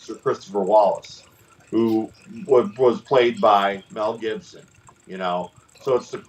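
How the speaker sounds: normal talking voice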